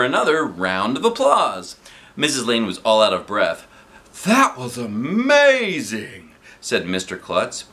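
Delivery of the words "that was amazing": impressed principal. A narrator's reading. Principal praising, voiced.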